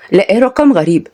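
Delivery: ordinary delivery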